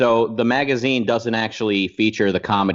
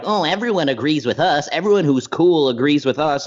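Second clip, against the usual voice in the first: mock smug; 5 semitones higher than usual. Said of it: "act like".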